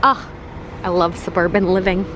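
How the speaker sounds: normal talking voice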